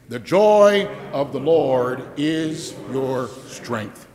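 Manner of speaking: slow, every word spaced; slow unison recital. Shown as a demonstration. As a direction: lead the crowd through it slowly. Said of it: "Say that with me".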